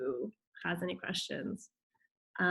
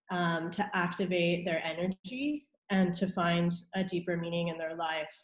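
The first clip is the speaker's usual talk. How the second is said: ordinary talk, nothing marked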